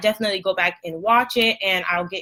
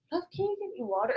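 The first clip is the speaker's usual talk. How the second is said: quiet aside off-mic; 8 semitones higher, 11 dB quieter, faster than usual. Real, not acted. Asks partner off-mic for water.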